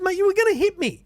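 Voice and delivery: to the van driver, a high loud indignant retort; retold heated retort.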